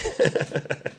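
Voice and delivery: soft, voiceless chuckle; quiet laugh. Real, not acted. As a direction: laugh under your breath at the absurdity. Laughs at his own mention.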